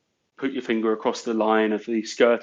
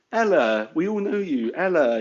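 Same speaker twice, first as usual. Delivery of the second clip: mock exclamation; 5 semitones higher than usual. Job applicant greets a company by its nickname, emphatic and high. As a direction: call it out as if it's common knowledge. Acted quote, recognising a known name.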